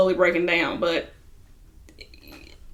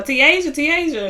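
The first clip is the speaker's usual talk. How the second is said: higher-pitched imitation of calling after someone; mock call-out, pitched up on the name; 6 semitones higher than usual. Friend calls after upset girlfriend leaving the car, imitated calling out. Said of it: "calling out to her".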